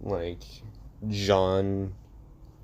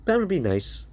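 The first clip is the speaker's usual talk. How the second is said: menacing smile; 11 semitones higher than usual. Acted. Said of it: "with his eyes closed and a menacing smile".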